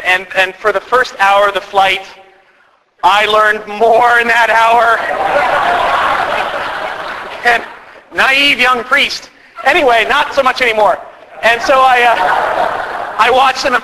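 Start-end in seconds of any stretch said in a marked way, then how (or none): 5.0-7.4 a silent, breathy laugh at himself. Real, not acted. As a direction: laugh it off under your breath. Laughing at his own story.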